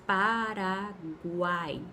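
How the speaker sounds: Paraguai drawn out very slowly, each sound of gu-ai held apart; slow syllable demo. Shown as a demonstration. Teacher spelling out Paraguai slowly for students. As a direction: stretch out Paraguai slowly so the gliding vowels are heard. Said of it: "É o que ocorre com o nome do país".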